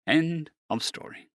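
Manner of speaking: ordinary delivery, nothing marked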